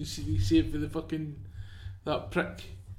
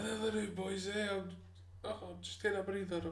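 lightly voiced, softer and a bit higher, fading; mock fading soldier; 4 semitones higher, 9 dB quieter than usual. Friend mocking the dying soldier, soft and fading. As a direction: play it weak and half-hearted, taking a breather. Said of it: "mocking the dying soldier".

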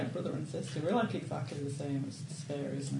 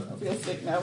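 normal talking voice

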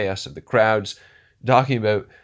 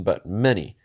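ordinary delivery

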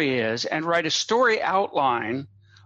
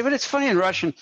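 higher, mock Russian accent, repeated for the laugh; bad Russian accent; 7 semitones higher, faster than usual. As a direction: imitate the Russian writers' accent, pitched higher. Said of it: "my Russian accent is terrible".

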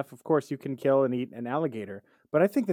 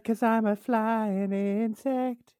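slow silly bee; 5 semitones higher, much slower than usual. Retold or imitated. Voicing the bee.

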